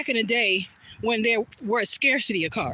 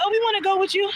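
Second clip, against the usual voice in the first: a touch of eager pleading; high, keen little ask; eager friends; 8 semitones higher, faster than usual. Retold or imitated. Friends begging to join her walk, eager high voices. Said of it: "And they said".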